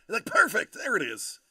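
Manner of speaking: loud, high burst of triumph; excited shout. Real, not acted. Viewer approves the accent, excited loud cheer. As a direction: cheer it out like you just won.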